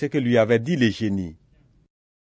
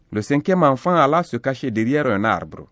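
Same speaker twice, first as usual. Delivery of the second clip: usual speaking voice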